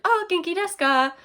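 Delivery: high, chirpy greeting in Japanese; eager Japanese greeting. Retold or imitated. Retells trying Japanese on a stranger.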